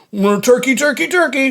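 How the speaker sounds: high gobbling turkey call. Retold or imitated. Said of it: "That's my Turkey impression".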